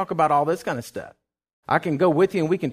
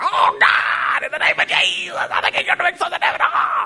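high-pitched, strained shouting to God; desperate prayer; 13 semitones higher, 4 dB louder than usual. Acted. Preacher reenacting his desperate prayer for church, frantic shout. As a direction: cry out with every emotion you can work up. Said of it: "it was everything I could work up of every emotion".

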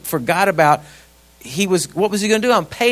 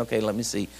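low, soft inner monologue; hushed, hesitant searching for words; thinking to himself; 8 semitones lower, 5 dB quieter than usual. Retold or imitated. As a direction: puzzle it out under your voice. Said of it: "'cause I'm thinking".

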